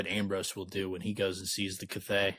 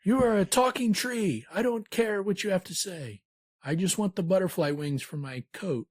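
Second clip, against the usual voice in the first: higher haughty voice, easing down toward the end; arrogant Ambrose; 9 semitones higher than usual. Retold or imitated. Ambrose brushing off the talking tree, haughty high voice. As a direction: dismiss the tree, want only its wings. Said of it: "Ambrose is just so arrogant".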